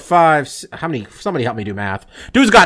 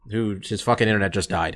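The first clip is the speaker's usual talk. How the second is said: muttered under his breath, softer; annoyed mutter; 6 semitones lower, 9 dB quieter than usual. Real, not acted. Host grumbling that his guest's internet died, muttered softly. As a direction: grumble it quietly to yourself, half to the others. Host's guest drops off the call.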